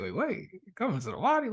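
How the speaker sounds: wait, what, into the water; boy doubting the guru's order; incredulous question. Retold or imitated.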